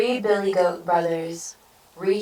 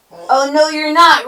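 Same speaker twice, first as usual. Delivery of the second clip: high, loud troll roar; blustering storybook troll; 10 semitones higher, 11 dB louder than usual. A narrator's reading. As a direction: roar the refusal at the goat. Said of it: "roared the troll".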